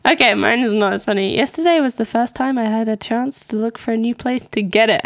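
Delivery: ordinary talk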